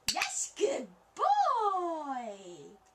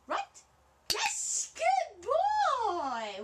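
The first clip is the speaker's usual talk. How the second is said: squeaky praising a dog; 8 semitones higher, 3 dB louder than usual. Real, not acted.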